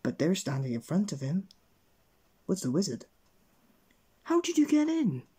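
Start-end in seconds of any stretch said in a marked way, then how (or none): 4.2-5.2 high, loud startled question; shocked gasp. A narrator's reading. Mister Mean finds the wizard in his kitchen, gasping in shock. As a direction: blurt it out in astonishment. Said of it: "gasped Mister Mean".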